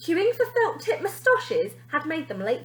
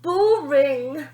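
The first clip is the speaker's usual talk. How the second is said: huff; 3 semitones higher, 7 dB louder than usual. A narrator's reading. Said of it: "huffed Minty".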